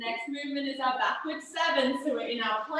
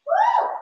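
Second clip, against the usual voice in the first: very high, loud whoop; excited whoop; 8 dB louder than usual. Real, not acted. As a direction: shout a big whoop. Cheering mid-exercise.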